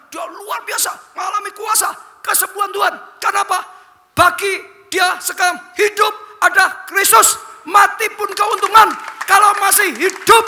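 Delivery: high, loud shouting sermon climax; preaching at full force. Acted. Preacher proclaiming the verse to his congregation, full-force shout. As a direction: drive the verse home at full voice.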